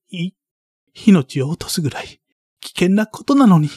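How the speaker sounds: put-on high, rising boy's voice pressing harder; a desperate boy demanding the truth; upset pleading boy.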